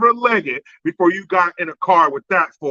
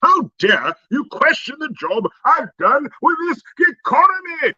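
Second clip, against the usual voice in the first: mock incensed; 8 semitones higher than usual. Comedian mocking the president's indignant reply, in hammed-up high outrage. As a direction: play offended indignation to the hilt. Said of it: "he was almost incensed".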